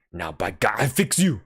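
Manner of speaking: a driver grabbing a club to punish the dog; angry threat. Acted. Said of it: "Francois was angry".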